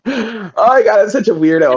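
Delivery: high, laughing mortification; real flustered, giggly outburst; embarrassed. Real, not acted. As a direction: laugh helplessly at your own weirdness. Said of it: "it's so embarrassing".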